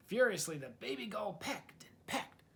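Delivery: ordinary delivery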